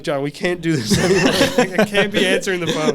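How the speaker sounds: loud retold plea through laughter; amused mock exasperation; laughing protest. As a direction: protest to a friend while cracking up.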